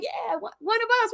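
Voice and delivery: high, thin mock cheer; small high-pitched imitation of cheering; cheering along. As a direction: join the chant as an eager hanger-on. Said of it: "And Nog starts cheering".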